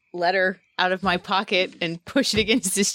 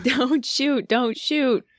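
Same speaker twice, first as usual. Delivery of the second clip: higher repeated call, a touch louder; mild mock shout, pitched up; shouting in character; 6 semitones higher, much slower than usual. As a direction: plead with the soldiers not to fire. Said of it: "start shouting as loud as I possibly can".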